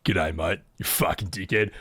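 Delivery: low, broad Australian greeting; mock Aussie mate on the phone; deep put-on Australian accent; Ocelot as an Australian. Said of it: "Ocelot's actually just Australian".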